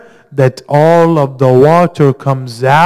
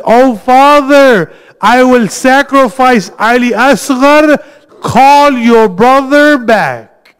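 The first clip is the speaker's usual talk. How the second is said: high, raised cry of desperate appeal; retold crying shout; 8 semitones higher than usual. Child begging her father to save her uncle, retold shout. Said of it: "She shouts and she cries".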